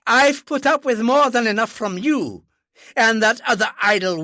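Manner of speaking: loud, high, furious tirade; angry father shouting at his daughter; furious outburst. Acted. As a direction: let him rage at her.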